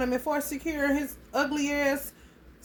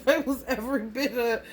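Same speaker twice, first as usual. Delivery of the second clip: words broken up by real laughter; tickled, giggly storytelling; laughing while talking; 2 semitones higher than usual. Real, not acted. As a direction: crack up halfway through the sentence. Cracking up at the story.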